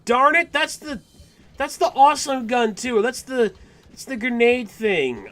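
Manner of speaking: high-pitched, loud dismay; frustrated outburst. Real, not acted. Gamer seeing the awesome gun he can't afford, high frustrated outburst.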